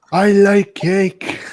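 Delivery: put-on drawn out, high and loud, a dim old priest; silly slow puppet voice. Voicing the Father Jack puppet for the holiday skit, slow and high. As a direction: stretch I like cake out slowly like a dotty puppet.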